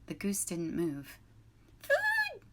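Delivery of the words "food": very high chick peep; gosling peep. A narrator's reading. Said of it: "peeped the gosling".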